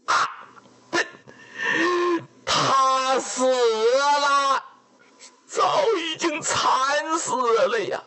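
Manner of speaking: wailing grief. Acted. Messenger telling the father his daughter died, crying out. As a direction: let the news break out of you in a wail. Messenger confirms the queen's death.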